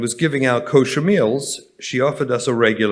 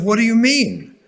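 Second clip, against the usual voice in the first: high, louder shocked question; startled, raised-pitch what do you mean; 9 semitones higher, 5 dB louder than usual. Retold or imitated. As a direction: react as if you can't believe it. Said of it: "I was shocked".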